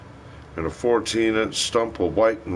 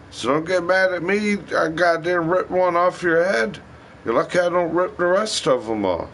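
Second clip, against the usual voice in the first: raised, cocky mock threat; teasing taunt; 10 semitones higher than usual. Man taunting his angry partner, a raised cocky tease. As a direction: needle her, playing tough.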